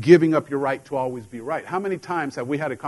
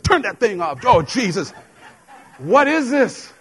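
higher, louder, laughing panic; spooked outburst; 8 semitones higher, 5 dB louder, slower than usual. Retold or imitated. Husband spooked by the TV preacher, high and laughing. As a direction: freak out at the TV. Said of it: "I'm like".

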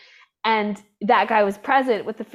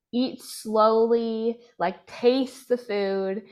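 slow and calm; 2 semitones higher, much slower than usual. Retold or imitated. Therapist models eating slowly.

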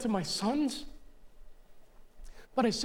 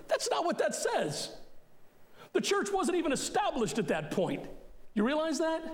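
higher, incredulous rebuttal; raised, disbelieving pushback; exasperated correction; 7 semitones higher than usual. Real, not acted. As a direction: set them straight, pitch up in disbelief. Rebutting the misquote.

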